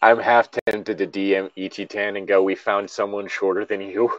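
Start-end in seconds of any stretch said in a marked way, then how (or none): none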